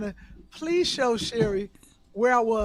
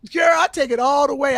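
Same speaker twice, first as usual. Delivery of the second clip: loud boast; 8 dB louder than usual. Real, not acted. Boasting to friends.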